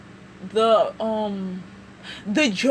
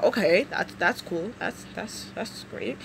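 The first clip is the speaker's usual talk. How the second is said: fading unimpressed; 5 semitones lower, 8 dB quieter than usual. Retold or imitated. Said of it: "you're sitting here like".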